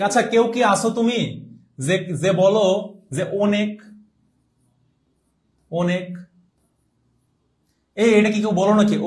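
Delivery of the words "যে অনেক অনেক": "অনেক" opening on a rounded o; mock-wrong "অনেক" with closed o; wrong pronunciation demo. Shown as a demonstration. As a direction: say "অনেক" as o-nek, the mistaken way. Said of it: "এটা হচ্ছে ভুল".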